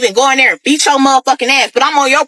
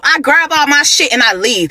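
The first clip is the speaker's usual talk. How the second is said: ordinary talk, nothing marked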